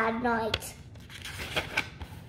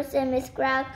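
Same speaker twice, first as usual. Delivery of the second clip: plain reading voice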